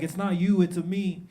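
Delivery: lilting cartoon Italian plumber; Mario. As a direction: do a comic Mario impression. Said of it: "And the guy was Mario".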